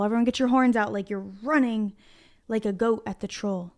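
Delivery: plain reading voice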